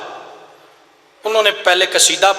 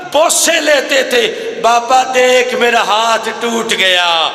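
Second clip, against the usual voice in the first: wailing lament; 6 semitones higher than usual. Acted. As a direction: cry it out at the grave, voice breaking. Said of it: "محمد کی بیٹی کہتی ہے".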